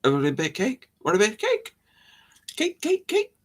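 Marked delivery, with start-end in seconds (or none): 1.0-3.3 high, silly tempting voice; playful coaxing. Pretend. Tempting listeners with cake, high silly coaxing. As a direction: chirp the offer with rising endings.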